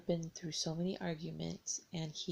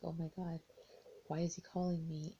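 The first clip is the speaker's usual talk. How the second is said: usual speaking voice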